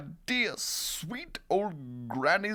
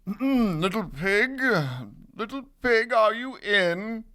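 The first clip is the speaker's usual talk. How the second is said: higher, sing-song call at a door; 7 semitones higher, 3 dB louder than usual. Acted. Wolf calls at little pig's door, calling out. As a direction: call in to see who is home. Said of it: "so I called".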